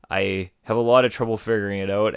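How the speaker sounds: usual speaking voice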